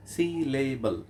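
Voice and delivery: সিলেবল slowly in three separate beats; split into syllables. Shown as a demonstration. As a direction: break সিলেবল up as si-le-bol. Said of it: "এর মধ্যেই তিনটা সিলেবল আছে".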